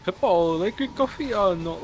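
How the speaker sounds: loud mock Italian accent yell. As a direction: shout it in a hammy Italian accent. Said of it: "comes in with an Italian accent to yell".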